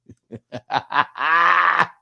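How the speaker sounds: high shrieking cackle; uncontrolled squeal of laughter; loud laugh. Real, not acted. Cracks up at Kitty's face.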